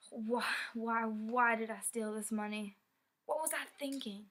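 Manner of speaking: loud, regretful outburst, pitch climbing at the end; anguished self-reproach. Acted. Thief asking himself why he robbed his friends, anguished. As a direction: berate yourself, voice rising.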